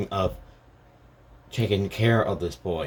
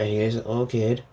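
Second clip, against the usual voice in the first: ordinary talk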